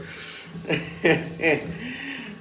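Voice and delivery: giggling fit after a joke; high laugh. Real, not acted. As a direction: burst out giggling.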